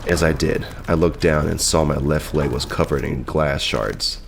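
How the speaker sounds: ordinary delivery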